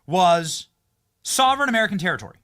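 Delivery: a lightly heightened, dramatic podcast host voice; punchy host monologue. Acted. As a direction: tell it like a breathless discovery to your listeners. Host's punchy solo monologue.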